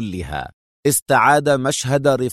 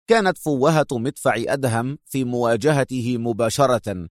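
ordinary talk, nothing marked

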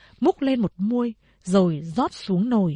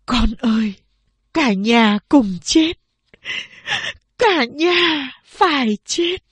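slow, heavy, louder lament; despairing mother; 4 semitones higher, 4 dB louder, slower than usual. A narrator's reading. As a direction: drag each word out in despair. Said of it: "Mẹ nói". (mẹ tuyệt vọng nói với con, chậm và nặng nề)

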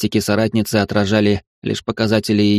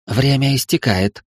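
slow, higher seductive demoness; goddess voice; 5 semitones higher than usual. Acted. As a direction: draw it out like a goddess. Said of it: "произнесла Астарта".

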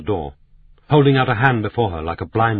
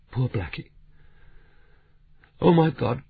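almost in tears; 5 semitones higher than usual. Acted. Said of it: "almost in tears".

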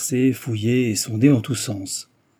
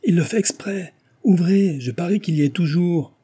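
high, insistent sceptical outcry; crying out; 8 semitones higher, 4 dB louder than usual. A narrator's reading. Sceptical Susanna demands the chair be opened, crying out. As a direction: cry out in disbelief, pitched well up.

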